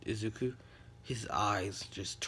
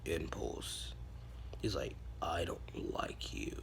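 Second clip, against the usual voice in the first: slow, whispered rejection; cold whisper; 5 semitones lower, 6 dB quieter, slower than usual. Acted. Izuku coldly rejecting Uraka, slow whisper. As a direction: hush it out icily.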